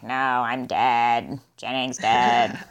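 low, very slow alien voice; silly alien voice. Retold or imitated. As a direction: drag it out like a goofy possessed alien. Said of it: "he does have a really silly alien voice".

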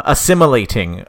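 normal talking voice

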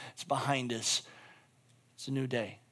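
low, hushed words of comfort; gentle reassurance. Acted. Preacher voices God forgiving him, gentle reassurance. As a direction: close the matter softly and kindly.

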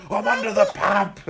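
high, loud hammed-up punchline; over-the-top coach gag; mock proud shout. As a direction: belt out the silly pun. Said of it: "He's like".

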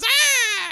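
high, loud scream; mock nightmare scream. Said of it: "she wakes up again and goes".

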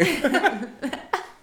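a real high laugh; shared laughter. Real, not acted. Both laughing at Walmart story.

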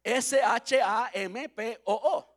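S H A M P O O slowly letter by letter, laughing; spelling out letters. Shown as a demonstration. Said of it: "en inglés es".

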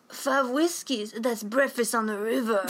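exaggerated imitation of an actor's odd accent; Jon Voight's movie accent. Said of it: "that's the fucking accent he's doing".

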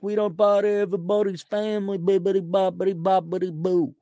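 put-on old-time Chicago mobster patter; hammed-up thirties gangster impression; 1930s Chicago gangster. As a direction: play a mock gangster from an old movie. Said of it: "some nineteen thirties Chicago gang".

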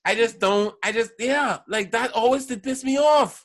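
getting worked up, almost shouting; heated rising complaint. Real, not acted. Annoyed rant about McNabb.

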